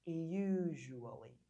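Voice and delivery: usually stretched very slowly; slow model pronunciation. Shown as a demonstration. Teacher models the word again, very slow. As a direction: draw out usually calmly. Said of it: "o importante é você conseguir pronunciar".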